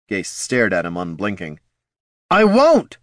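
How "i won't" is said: high, loud outburst; shouted refusal. A narrator's reading. Bard to wounded friend, shouted refusal. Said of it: "shouted the bard".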